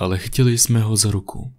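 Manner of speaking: ordinary delivery, nothing marked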